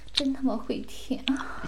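soft unvoiced whisper; 轻声耳语. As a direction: breathe it out under the breath. Whispered aside.

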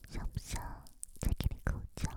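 whispering around a lollipop; sucking a lollipop. Acted. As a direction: talk with a lollipop in mouth. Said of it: "我的嘴巴里含着棒棒糖的声音". (含着棒棒糖说话)

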